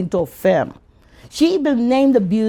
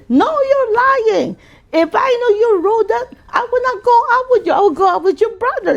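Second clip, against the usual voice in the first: mock indignant girl; 14 semitones higher, 4 dB louder than usual. Classmate rejecting, acted quote.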